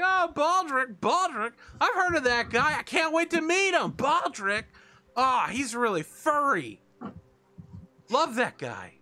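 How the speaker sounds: high, gushing fan squeals; excited rat crowd. Acted. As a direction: gush like a pack of starstruck rats. Excited rats gush over Baldric.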